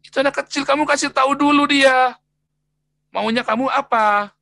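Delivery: high, loud, urgent telling-off; loud pleading rebuke to a harsh father. Retold or imitated. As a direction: stop a father from hitting his child, loud and high. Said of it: "Terus saya bilang".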